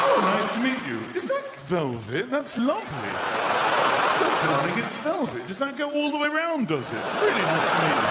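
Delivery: loud, delighted small talk; hammed-up fascination with someone's trousers; gushing admirer. Acted meeting over velvet trousers.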